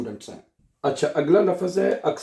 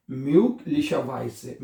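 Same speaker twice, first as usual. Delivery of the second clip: very slow model word; much slower than usual. Shown as a demonstration. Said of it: "क्या बनेगा?".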